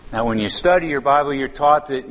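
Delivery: normal talking voice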